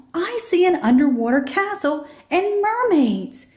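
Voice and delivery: put-on high, thrilled child voice; a child showing off a magical find; eager high call to the family; excited calling out. A narrator's reading. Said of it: "she yells".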